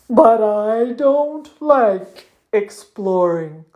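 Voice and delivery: slow, drawn-out muskox moan; miserable whining. A narrator's reading. Muskox protesting to his friend mid-river, miserable. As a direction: drag the complaint out miserably.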